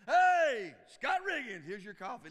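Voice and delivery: loud, high call across the coffee shop; barista calling out. Acted. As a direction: shout the name like a barista.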